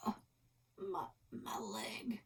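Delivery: slow whispered groan; hammed-up injured cowboy; mock pained whisper. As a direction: moan it weakly as the wounded one. Wounded partner in pretend game.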